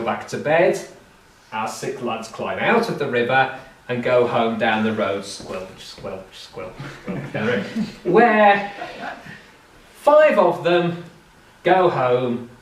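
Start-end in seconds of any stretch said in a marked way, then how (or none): 5.2-7.3 squelching steps. Retold or imitated. Wet boys walking home.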